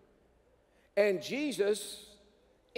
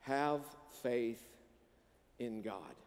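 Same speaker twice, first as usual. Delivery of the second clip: slow soft emphasis; 6 semitones lower, 7 dB quieter, slower than usual. Shown as a demonstration. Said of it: "Jesus just said".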